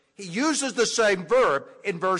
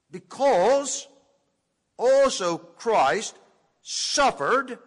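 slow, raised voice with a pause after each phrase; deliberate scripture reading; 3 semitones higher, 4 dB louder, much slower than usual. Shown as a demonstration. Preacher reads the eighteenth verse this way, slow and deliberate. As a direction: read it out word by word, each phrase left hanging. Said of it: "I'm going to read it this way".

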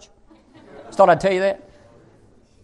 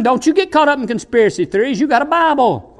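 ordinary delivery

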